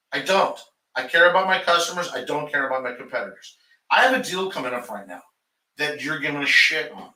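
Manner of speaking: ordinary talk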